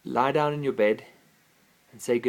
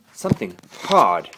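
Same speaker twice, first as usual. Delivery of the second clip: plain reading voice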